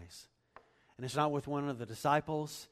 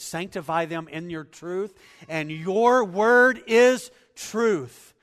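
loud, higher impassioned preaching; preaching at full force; 7 semitones higher, 14 dB louder than usual. Acted. Preacher proclaiming truth's power to congregation, loud and fervent. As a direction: drive it home with fervor.

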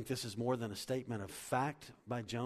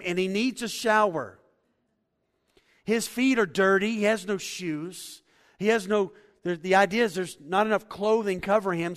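usual speaking voice

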